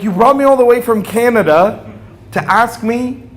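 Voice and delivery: high, loud fed-up outburst; exasperated dad. Retold or imitated. Father who flew from Canada scolds his hesitant son, exasperated.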